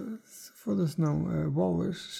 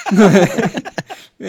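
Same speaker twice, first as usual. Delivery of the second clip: laughing; 4 semitones higher, 8 dB louder, much slower than usual. Real, not acted. Still laughing after demo laugh.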